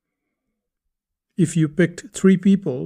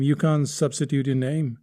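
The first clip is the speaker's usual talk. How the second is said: normal talking voice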